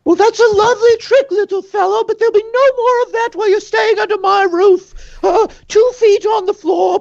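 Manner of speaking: very high, prim scolding landlady voice; prim barkeep. Acted. Betty scolding the gnome about her house rules, very high and prim. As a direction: lay down the house rules sweetly but firmly. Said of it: "Betty is just like".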